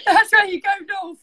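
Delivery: tearful helpless laugh; laughing through it. Real, not acted. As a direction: push the words out through giggles. Giggling fit over north.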